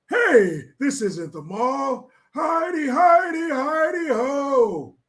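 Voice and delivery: put-on sing-song old grandpa calling out; mock Biden impression. Vlogger mocks Biden calling out in a high sing-song. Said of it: "our grandpa in chief, Mister".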